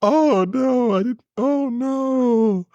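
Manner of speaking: shocked dismay. Real, not acted. Shocked by racist line.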